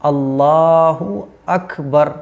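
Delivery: अल्लाहु अकबर very slow, with the u of अल्लाहु clear; corrected अल्लाहु अकबर, slow and careful; slow, clear pronunciation. Shown as a demonstration. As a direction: calmly spell out अल्लाहु अकबर, slow and clean. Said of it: "pronunciation क्या है".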